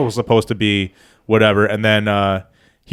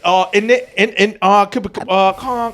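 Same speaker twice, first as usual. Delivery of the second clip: high, halting mock British; badly faked British, pitched up; bad British accent; 9 semitones higher, slower than usual. Shown as a demonstration. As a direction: fumble through a fake British voice. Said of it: "good British accent".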